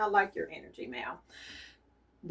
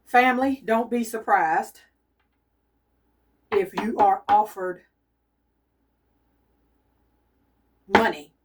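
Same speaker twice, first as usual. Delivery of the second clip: emphatic warning; 3 semitones higher, 5 dB louder than usual. Real, not acted. Psychic warning her family viewers, loud and urgent.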